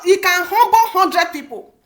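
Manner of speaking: ordinary delivery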